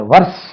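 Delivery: "வர்ஸ்" with a short vowel and sounded r; wrong pronunciation shown. Shown as a demonstration. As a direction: say "வர்ஸ்" the mistaken way, r pronounced. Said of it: "அப்படி கிடையாது". ("வர்ஸ்" என ர் ஒலியுடன் தவறாக)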